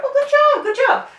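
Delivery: very high, gushing "good job"; sing-song over-the-top praise. Shown as a demonstration. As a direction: overdo the praise. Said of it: "constantly have to go".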